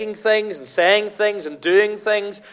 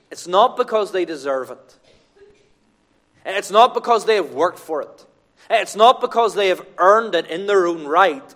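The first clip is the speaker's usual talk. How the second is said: normal talking voice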